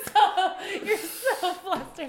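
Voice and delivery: high, loud laughing imitation of flustered apology; mock his apology through hard laughter; laughing mimicry.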